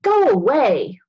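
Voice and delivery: loud angry yell to clear them off; shout. Acted. Brother yelling at his sister and friend, loud. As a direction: yell them away from the yard.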